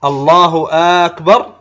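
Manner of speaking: "Allahu aakbar?" with a long questioning hamza; lengthened hamza. Shown as a demonstration. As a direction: stretch the opening a of أكبر into a question. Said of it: "هذا هكذا يمدها".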